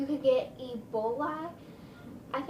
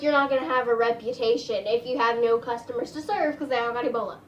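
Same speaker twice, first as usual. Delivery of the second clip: ordinary talk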